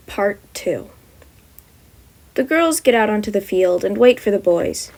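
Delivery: plain reading voice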